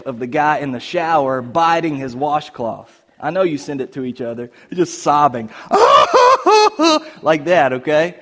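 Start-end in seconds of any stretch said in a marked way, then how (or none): 5.6-7.2 high shouted laugh; real loud laugh; loud burst of laughter. Real, not acted. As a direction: crack up loudly. Laughs at the crying gif.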